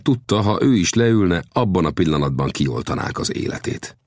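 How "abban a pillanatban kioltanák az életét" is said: ordinary delivery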